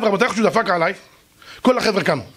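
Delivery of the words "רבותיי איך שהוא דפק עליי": ordinary delivery, nothing marked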